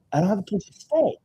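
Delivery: high, put-on helpless voice; student whining about lodging; whiny student. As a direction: play the needy student. Student's new complaint.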